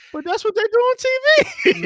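high-pitched whiny protest, acted out; comic squeak of disappointment; mock disappointed protest. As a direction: protest way up high like a let-down client. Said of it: "but I was like".

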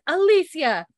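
high, loud summoning call. Retold or imitated. Woman fetching Alicia from street, calling out. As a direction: call the name insistently. Woman calls the girl again.

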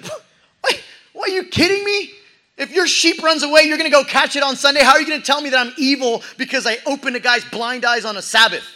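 high, loud, rapid-fire objection; outraged disbelief. Acted. Jesus argues with Pharisees, loud and outraged. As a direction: throw their logic back at them, stunned. Jesus answers the charge of evil.

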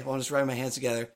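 ordinary delivery

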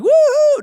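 loud, high-pitched thrill-ride whoop. Retold or imitated. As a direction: whoop like a rider on a coaster. Imitates himself whooping on pretend ride.

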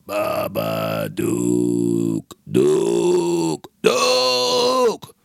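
drawn-out creature croak rising to a shriek; Babadook croak. Comic doing the Babadook's creepy call. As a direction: croak the monster's name, then screech it.

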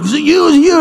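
high-pitched imitation of people blaming; mock accusing question. People behind accuse each other.

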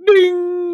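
high bell ding; high ding sound. Acted. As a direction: sing a ding. Wheel sound effect.

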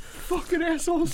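mock local insult. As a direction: sneer it in a squeaky mocking voice. Mocking locals' insult, put-on voice.

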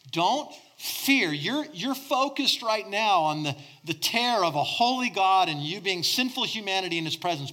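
normal talking voice

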